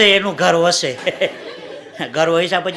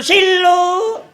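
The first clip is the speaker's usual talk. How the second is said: high, slow, drawn-out tender call; loving call; 10 semitones higher, 4 dB louder, much slower than usual. Retold or imitated. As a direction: call her name lovingly. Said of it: "પ્રેમથી એને શીલું કહું છું". (પ્રેમથી ઊંચા ધીમા સૂરે નામ પુકારવું)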